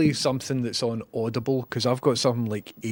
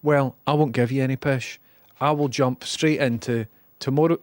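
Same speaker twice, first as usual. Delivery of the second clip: ordinary talk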